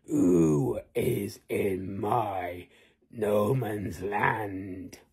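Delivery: slow, low giant voice. Acted. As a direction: growl it like a suspicious giant. Giant asks who is there.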